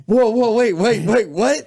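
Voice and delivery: high, loud flustered protest; a stranger caught off guard; high startled stammer; mock bewildered. Stranger voiced, confused.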